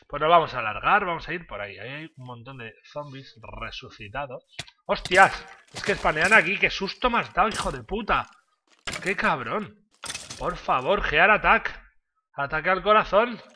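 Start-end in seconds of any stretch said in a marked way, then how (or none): 4.9-13.4 high, loud frightened outburst; startled shouting. Real, not acted. Startled by spawning zombies.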